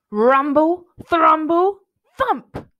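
loud, rising in pitch word by word; booming storybook sound effects; rumbling build. A narrator's reading. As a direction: build it to a thump. Noise growing under the floor.

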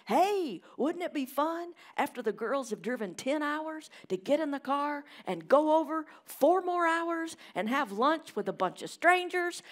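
high, loud, sarcastic Dad voice; mock cheery Dad. Preacher mocking Dad's cheery Thanksgiving plan, high and loud. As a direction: ham up Dad's bright idea. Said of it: "he said it like this".

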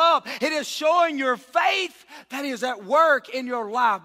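loud preaching shout; fervent sermon shout; full-force preaching. Acted. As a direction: preach it out to the back row.

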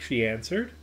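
ordinary delivery

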